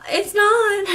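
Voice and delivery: high, loud mock wail; upset protest. Bawling patient answering the nurse, acted distressed protest. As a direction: cry out against the comfort, pitch leaping.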